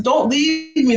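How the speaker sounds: retold high-pitched plea; beg, with the voice lifted; imitating her own anxious begging; pleading.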